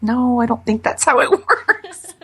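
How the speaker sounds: high-pitched reply breaking into laughter; amused retort. Retold or imitated. Amused retort to husband's suggestion.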